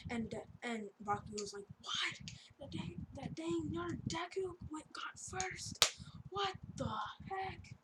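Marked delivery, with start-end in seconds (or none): 1.8-7.7 high, strained whispery mock yell; hammed-up disbelief, squeezed high and airy; mock outrage. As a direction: imitate a fuming rival without full voice. Retold rival's outrage at losing first.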